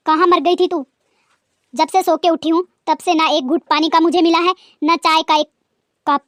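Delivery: loud, sharp rebuke; angry scolding. Acted. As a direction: rebuke her loudly for neglect. Mother-in-law scolding daughter-in-law.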